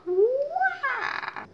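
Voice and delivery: high squeaky wah; a silly high yelp going to break; playful squeal. Real, not acted. Vlogger squeals to viewers before cutting away, high.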